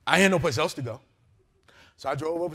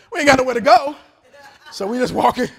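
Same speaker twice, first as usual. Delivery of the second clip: preaching loud; 7 semitones higher, 10 dB louder than usual. Acted. Preacher acting the slow walk.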